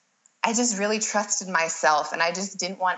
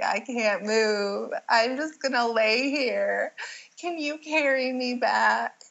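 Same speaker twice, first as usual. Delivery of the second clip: whiny plea; 5 semitones higher, slower than usual. Retold or imitated. Exhausted labor plea on beach.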